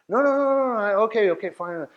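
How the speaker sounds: high, rushed no no no then giving in; a caught troll hastily caving; flustered backpedaling. Acted. Troll caught out, hastily caving in, high then settling. Troll backing down when called out.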